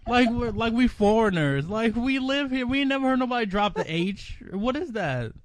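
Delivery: high, heated classroom protest; acted-out incredulous objection; indignant disbelief. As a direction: rant upward in baffled outrage.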